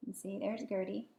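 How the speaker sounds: usual speaking voice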